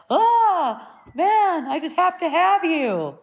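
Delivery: high-pitched mock yearning; craving ego voice. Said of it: "this thing arises where it's like".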